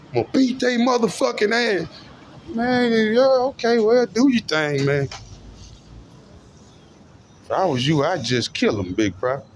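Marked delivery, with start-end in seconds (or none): 0.1-5.1 hammed-up high voice for the imagined crony; mock follower voice. Speaker plays a crony answering Big Prophet, high mock voice.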